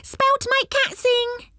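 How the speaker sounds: high, slow drawn-out gnome call; slow gnome cry. Acted. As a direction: stretch it out like a squeaky gnome. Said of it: "cried another gnome".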